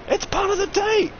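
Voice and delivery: very high squeaky punchline. Acted. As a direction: squeak the joke.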